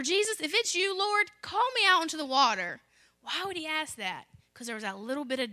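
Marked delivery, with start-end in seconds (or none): 0.0-2.8 lightly acted, raised calling-out voice; pleading Peter. Peter asking Jesus to call him out, raised appeal. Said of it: "So Peter asked him".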